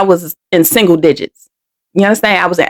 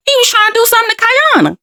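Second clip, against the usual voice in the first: much higher, fast, urgent outburst; imitating an alarmed friend; 13 semitones higher, faster than usual. Friend telling others what the man did, in a fast alarmed high voice. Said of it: "You like".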